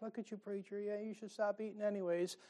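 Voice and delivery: congregant's soft teasing dig at the preacher; mock teasing aside. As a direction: toss it off under your breath. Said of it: "And like".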